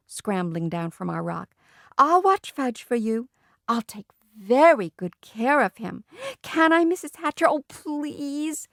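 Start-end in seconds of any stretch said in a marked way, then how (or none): 2.0-8.7 high, eager pleading; begging. A narrator's reading. Sheila begging to watch little Fudge, pleading. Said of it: "begged some more".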